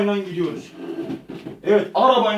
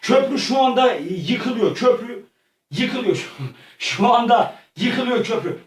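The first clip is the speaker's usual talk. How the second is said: normal talking voice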